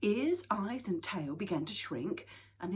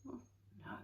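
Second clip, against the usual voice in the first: ordinary delivery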